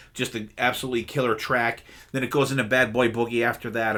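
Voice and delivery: usual speaking voice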